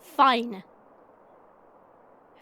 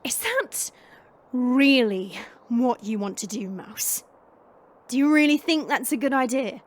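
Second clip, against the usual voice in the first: lowered, measured challenge; menacing warning; 9 dB louder than usual. Acted. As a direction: coolly warn off a foolish attacker. Fighter coolly warns attacker.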